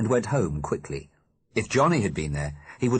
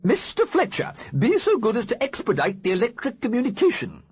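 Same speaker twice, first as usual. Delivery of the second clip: much higher, louder grand-dame command; imperious old lady ghost; 4 dB louder than usual. Acted. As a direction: order the servant about with lofty airs. Mrs Liberty commands Mr Fletcher.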